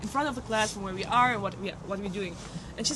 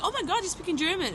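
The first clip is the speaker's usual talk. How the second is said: high, eager gush at meeting a fellow German; light up, thrilled to hear German; retold excited squeal; 7 semitones higher than usual.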